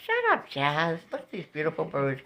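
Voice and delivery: ordinary delivery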